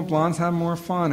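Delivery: ordinary talk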